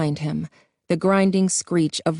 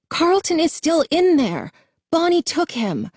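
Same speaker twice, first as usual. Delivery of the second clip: much higher, frantic falling cries; desperate cry; 11 semitones higher than usual. A narrator's reading. Jason telling the group Carlton is still inside, frantic high cry. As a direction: plead in a panic. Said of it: "Jason cried out again".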